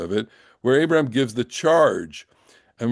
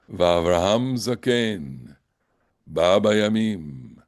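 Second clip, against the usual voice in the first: slow motion reading; 2 semitones lower, slower than usual. Shown as a demonstration. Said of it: "I wanna read it in s-, total slow motion".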